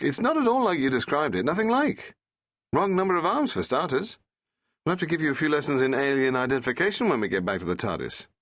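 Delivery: Rose's voice. Acted. Rose asking the Doctor about the sea, a young woman's voice.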